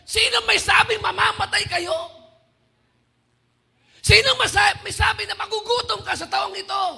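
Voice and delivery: loud, high impassioned sermon; full-force preaching. Acted. Preacher voices the Lord's rebuke to fearful believers, impassioned preaching. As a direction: thunder God's challenge to the congregation.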